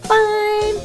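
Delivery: high, bubbly mother voice; cheerful mom voice. Acted. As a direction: chirp it like a doting mom.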